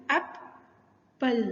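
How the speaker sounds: Apple drawn out slowly as एप... पल; syllable by syllable. Shown as a demonstration. Teacher splitting the word for learners, Apple in slow syllables. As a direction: say Apple slowly, a gap between its two syllables.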